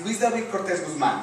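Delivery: name in a flowing Mexican Spanish accent. Shown as a demonstration. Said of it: "when I say it in my Mexican accent".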